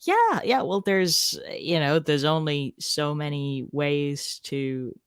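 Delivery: normal talking voice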